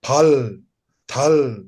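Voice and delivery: low, punchy phal and thal; demonstrating Kham pronunciation; Kham-style phal, thal. Shown as a demonstration. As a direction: clip out phal, thal as the Kham accent says them. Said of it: "just like you are saying".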